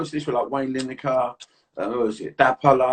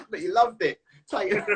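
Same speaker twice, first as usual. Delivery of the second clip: laughing; 4 semitones higher than usual. Real, not acted. Laughing at the story.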